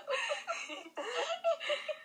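long high laughter. Real, not acted. (carcajada larga y aguda)

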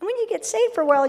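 preacher's sudden surge; excited burst. Real, not acted. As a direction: fire it out with zeal.

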